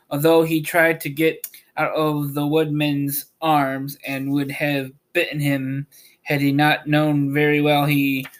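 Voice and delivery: ordinary delivery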